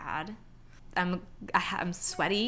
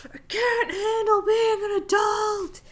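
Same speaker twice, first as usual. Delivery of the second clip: high, loud, slow crying wail; mock meltdown; 10 dB louder, slower than usual. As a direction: scream it in hammed-up despair. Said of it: "the volume's turned up".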